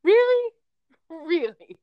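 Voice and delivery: high, loud mock-incredulous double take; high-pitched retold disbelief; imitated incredulity. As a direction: squeal it in disbelief, then drop a little. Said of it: "And he's like".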